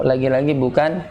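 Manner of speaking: usual speaking voice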